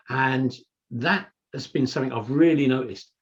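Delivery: normal talking voice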